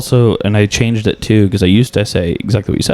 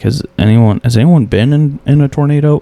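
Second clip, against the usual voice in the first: comic asking crowd; 6 semitones higher, 3 dB louder than usual. Retold or imitated. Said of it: "when comics ask".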